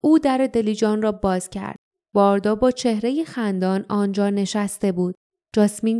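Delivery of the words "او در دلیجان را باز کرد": ordinary delivery, nothing marked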